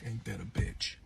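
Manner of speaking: hushed, breathy disbelief; whispered wry aside. Retold or imitated. To herself after he blames her, wry whisper.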